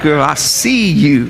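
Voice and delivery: put-on slow, smooth Girl, I see you; husband woos his wife, slow and smooth; smooth romantic line. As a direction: lay it on thick for your wife. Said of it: "you're like".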